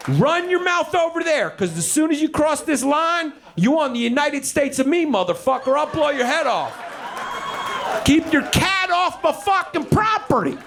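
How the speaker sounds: high-pitched hollering at a neighbour; irate property owner. Acted. Landowner warns Bill about his cat, irate high holler.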